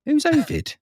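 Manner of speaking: suddenly high, puzzled question; baffled aside. Real, not acted. Host breaks from reading to ask, high and puzzled. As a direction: pop up in surprise at the name.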